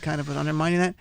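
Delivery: usual speaking voice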